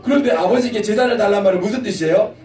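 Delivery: ordinary talk, nothing marked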